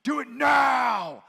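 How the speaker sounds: high, loud, slow yelled order; mock yell. Preacher mimicking his all-caps text as a yell. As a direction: bark it like a capital-letters text. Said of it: "thinks I yelled at them".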